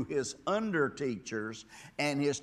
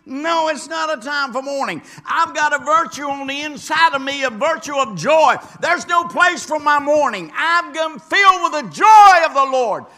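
very loud, high shouted proclamation; fiery climax on joy; preaching at full force; 12 semitones higher, 16 dB louder than usual. Acted. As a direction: preach it with everything.

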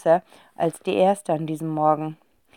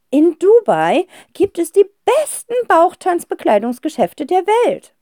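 put-on high, loud gushing old aunt; delighted old aunt; 11 semitones higher, 5 dB louder than usual. A narrator's reading. Aunt thrilled to hear her nephew, gushing. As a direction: gush with delight.

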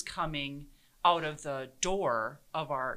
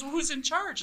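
mock puzzled old man; 11 semitones higher than usual. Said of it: "he goes".

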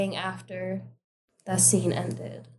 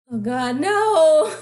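loud, high, drawn-out protest; long exclamation of dread; dismayed cry; 6 semitones higher, 8 dB louder, slower than usual. Real, not acted. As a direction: wail it as the sneeze threatens. About to sneeze, cries out.

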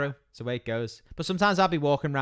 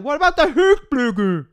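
mock Swedish; 10 semitones higher, 8 dB louder than usual. Husband mocks ikea names.

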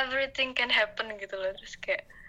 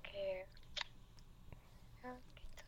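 whisper; 19 dB quieter than usual. Real, not acted. Trails off to whisper.

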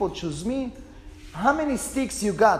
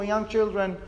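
usual speaking voice

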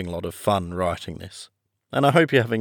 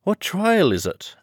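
panting; 11 semitones higher than usual. A narrator's reading. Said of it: "Alice panted as she ran".

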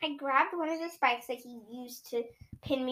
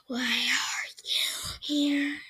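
slow, tired whisper; whispering tree; much slower than usual. Acted. Wounded Hearth Star whispering to the children. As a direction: whisper it wearily. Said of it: "a sort of whispering vibe".

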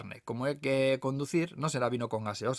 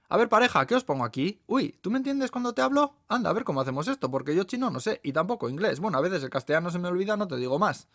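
higher, lively waitress voice; chatty waitress; 6 semitones higher, 4 dB louder than usual. Acted. Waitress greeting a foreign couple, chatty and lively. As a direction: play a brisk, animated waitress, pitched up.

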